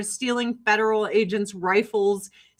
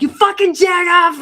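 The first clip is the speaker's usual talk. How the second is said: yelled much higher and louder; angry shout; 10 semitones higher, 10 dB louder than usual. Retold or imitated. Chicago drivers at a stoplight, angry shouting. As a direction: bark it like road rage at a stoplight. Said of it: "they're like".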